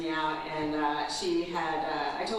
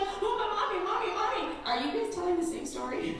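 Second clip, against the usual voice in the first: put-on much higher whiny child voice; little daughter; 11 semitones higher than usual. Mom imitating her daughter, much higher child voice. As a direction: play the panicked little girl. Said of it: "And then she's like".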